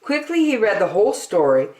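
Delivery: ordinary delivery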